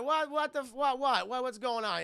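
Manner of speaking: imitating a startled mother; flustered mom. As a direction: sputter as if woken suddenly. Mom woken by frightened child.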